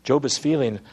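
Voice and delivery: ordinary talk, nothing marked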